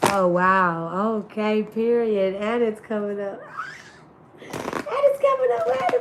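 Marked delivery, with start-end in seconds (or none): 2.3-3.5 laughing. Real, not acted. Laughing at a sign appearing. 4.9-6.0 high squeal. Real, not acted. Repeats it in a squeal.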